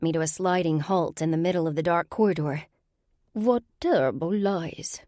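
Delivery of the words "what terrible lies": put-on slow, disdainful Russian-accented sorceress; heavy Russian accent. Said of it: "the heavily accented voice continued".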